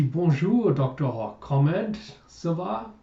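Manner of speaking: clumsy French greeting, lilting upward; atrocious French. Acted. Woman answering Jacob's call, in bad French. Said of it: "I apologize for my atrocious French".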